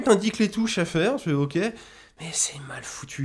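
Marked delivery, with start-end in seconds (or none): 1.6-3.2 a slow muttered whisper to yourself; whispered aside. Real, not acted. Muttered aside about bad game.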